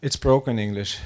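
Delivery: ordinary talk, nothing marked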